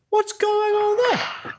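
high, slow squeaky mocking boss voice; mock Chief Irons. Mocking the police chief in a high, squeaky voice. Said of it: "Chief Irons is like".

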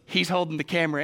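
usual speaking voice

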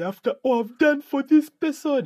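high, loud, wounded mock-complaint; mock wronged ex. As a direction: whine it like a hurt ex, hammed up. Mock venting after a breakup.

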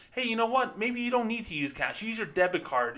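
a light mock sales pitch; mock banker. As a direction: sell it sweetly to the customer. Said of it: "he's basically saying to the people".

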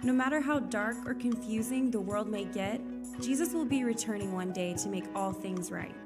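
ordinary delivery

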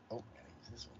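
hushed, breathy whisper; whispered aside. Retold or imitated. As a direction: whisper it to yourself. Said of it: "be like".

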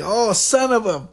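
high, drawn-out mock reaction; amused exclamation. Showing how classmates react to a joke, amused exclamation. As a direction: stretch it out high, as if cracking up. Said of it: "we all start laughing".

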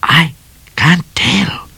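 high, hushed, serious cat. A narrator's reading. Cat answering Midnight from behind the rock, serious voice. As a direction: answer gravely in a high, halting voice. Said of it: "in a serious voice".

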